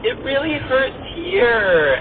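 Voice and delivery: put-on high, slow whimpering child; whiny kid voice. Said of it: "he's like".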